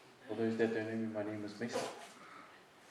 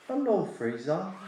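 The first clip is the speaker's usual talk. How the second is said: slow, higher mock grandma greeting; imitating Grandma; 8 semitones higher, 7 dB louder, much slower than usual. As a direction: drawl it out like his grandma. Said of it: "the first thing she says is".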